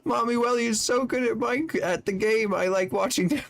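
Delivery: put-on high, quick little-kid voice, lightly done; imitating a child. Streamer quoting a child fan's praise, high childlike voice. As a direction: chirp it like an excited small boy. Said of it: "and he said".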